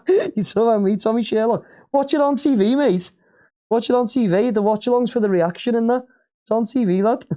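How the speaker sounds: high, cheeky jab at the viewer; mock him for moaning; teasing retort. Real, not acted. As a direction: watch it on TV, mate, taunting. Mocking reply to a viewer.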